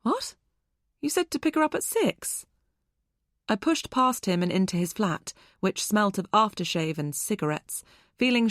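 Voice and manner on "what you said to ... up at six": high, startled what; shocked disbelief. A narrator's reading. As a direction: caught off guard, disbelieving. Shocked mother confronting ex.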